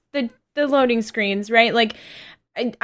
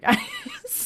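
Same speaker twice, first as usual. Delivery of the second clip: laughing; 8 dB quieter than usual. Real, not acted. Laughs, apologizing for coffee rush.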